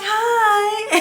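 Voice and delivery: high, drawn-out imitated "hi"; high-pitched mock greeting; doctor's cheery greeting. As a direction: mimic a tiny, bubbly doctor's hello. Acting out the doctor's bright greeting.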